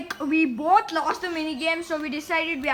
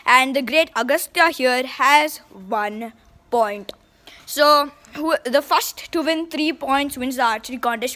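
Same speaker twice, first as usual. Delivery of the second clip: announcer voice; 10 dB louder than usual. Acted. Announces contest rules to camera.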